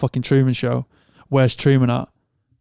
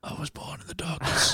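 breathy villain whisper, much softer; spooky whisper; 3 semitones higher, 7 dB quieter than usual. Pretend. Joking with his mate about darkness retreats, whispered. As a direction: whisper it like a movie bad guy. Quoting a film villain in jest.

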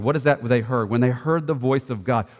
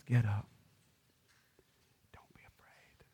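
soft, whispered comfort; tender Jesus; 9 dB quieter than usual. Acted. Jesus comforting the terrified disciples, soft whisper. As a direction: lift them up gently, almost breathless. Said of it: "and it's Jesus touching them. He says".

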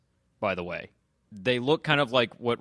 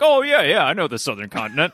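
mock eager agreement; 3 semitones higher, 7 dB louder than usual. Mocking the doctors' reply.

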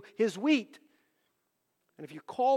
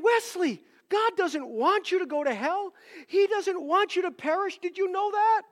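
much higher and louder, pressing the caller hard; retold earnest plea to a phone scammer; urgent pleading; 13 semitones higher, 7 dB louder than usual. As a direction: plead with him like his soul depends on it.

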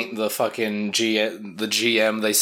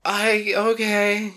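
very high, squeaky, laughing bewilderment; confused Job voice; 10 semitones higher, much slower than usual. Retold or imitated. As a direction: squeak it out like a clueless kid.

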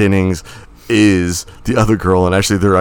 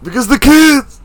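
mock Mister Glass; 13 semitones higher, slower than usual. Co-host echoing the Mister Glass bit, high mock cry. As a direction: start small and high, then cry it out.

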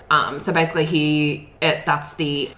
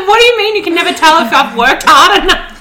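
laughing indignant quote; 11 semitones higher, 10 dB louder than usual. Retold or imitated. Retold complaint to trainer, laughing.

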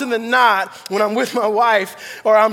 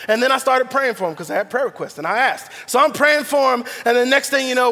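ordinary talk, nothing marked

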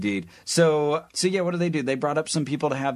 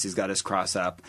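ordinary talk, nothing marked

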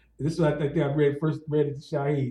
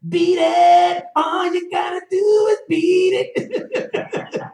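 hollered slow and high with a laugh; Shaheed's high, loud taunt; loud high mock shout; 14 semitones higher, 5 dB louder, much slower than usual. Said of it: "Shaheed said".

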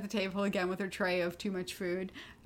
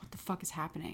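bewildered murmur; 4 semitones lower, 12 dB quieter, faster than usual. Retold or imitated. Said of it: "She's like".

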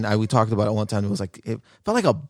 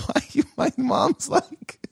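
laughing while talking; much slower than usual. Real, not acted. Laughing while telling story.

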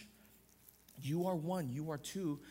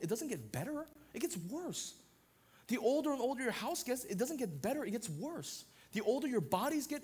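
raised, rhythmic preaching cadence; pitched-up pulpit delivery; 7 semitones higher, 3 dB louder than usual. Acted. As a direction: preach it, building line by line. Building toward the entropy point.

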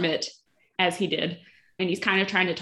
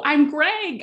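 louder, higher insisting take of the name; insistent Greg; 7 semitones higher, 5 dB louder than usual. Retold or imitated. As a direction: push the name a bit harder. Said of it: "And so Greg is like".

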